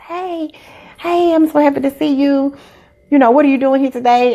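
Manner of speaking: jovial greeting. Retold or imitated. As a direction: greet them as if delighted to see them. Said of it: "I'm, like, very jovial".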